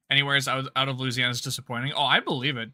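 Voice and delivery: ordinary talk, nothing marked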